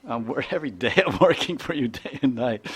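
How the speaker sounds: half-laughing protest, mock offended; laughing defensive reply. The husband laughs off his wife's complaint, mock defensive. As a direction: laugh it off while defending yourself. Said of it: "they criticize each other".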